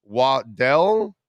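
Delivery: Waddell, stressed on the end; stressed second syllable. Shown as a demonstration. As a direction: say Waddell as wah-DELL. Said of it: "it's pronounced Waddell".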